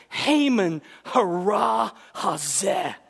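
"Haman hara hazeh" very slow, every syllable hit hard; each syllable stressed. Shown as a demonstration. Esther accusing Haman at dinner, every syllable hammered. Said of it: "emphasizing each syllable".

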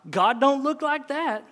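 high, loud scoffing; scornful rebuttal. Acted. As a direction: contradict him as an indignant wife. Wife scoffs in story.

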